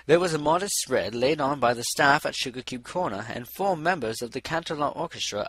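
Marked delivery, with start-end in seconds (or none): none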